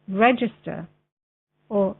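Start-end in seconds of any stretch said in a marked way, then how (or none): 1.7-2.0 measured reading with long pauses between phrases; slow, clear reading. Real, not acted. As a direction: read it out slowly and very clearly for a video. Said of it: "read it a little bit slower".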